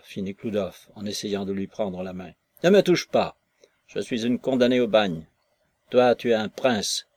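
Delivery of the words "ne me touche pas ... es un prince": furious cry. Acted. Maslova pulls her hand from Nekhludov, crying out in fury. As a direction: shout him off, wild with anger. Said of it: "cria-t-elle, folle de colère".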